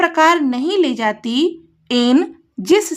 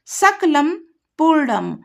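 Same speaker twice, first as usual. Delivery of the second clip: normal talking voice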